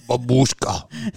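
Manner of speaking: slow Russian babushka. Shown as a demonstration. Russian accent, slowly teaching a friend babushka. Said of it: "You must say it like babushka".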